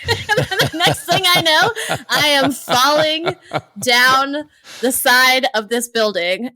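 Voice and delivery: slow, laughing through the words. Real, not acted. Guest laughing as she tells the host about falling. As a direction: laugh out the memory of the fall. Laughs while recalling the fall.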